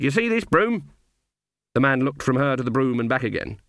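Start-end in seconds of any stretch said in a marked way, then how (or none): 0.0-0.9 high, insistent question; demanding. A narrator's reading. Granny confronting the innkeeper about her hat, demanding. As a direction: demand an answer on a raised pitch. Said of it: "she demanded".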